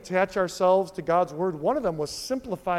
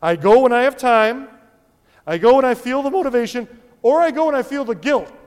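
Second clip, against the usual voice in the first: loud, higher-pitched parody of excuses; mock casual churchgoer; 5 semitones higher, 9 dB louder, faster than usual. Preacher mimicking a half-committed gym-style member, loud mock excuses. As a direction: reel off excuses loudly, pitched up.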